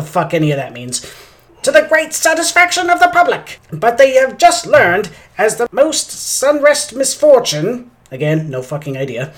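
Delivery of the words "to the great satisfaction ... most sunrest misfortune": high, loud, slow vintage announcer; drawn-out newsreel drama; old-timey radio voice. Pretend. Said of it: "I'm going to use my old-timey radio voice".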